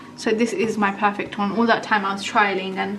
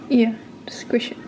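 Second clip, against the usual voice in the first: whispering; 2 semitones higher than usual. Real, not acted. Whispered aside while cooking.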